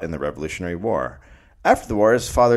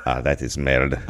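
mock French; 7 semitones lower than usual.

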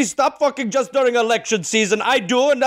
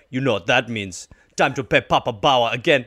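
deep ghost voice; 11 semitones lower than usual. Acted. Ghost voiced in podcast bit.

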